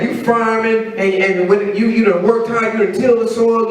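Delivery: ordinary talk, nothing marked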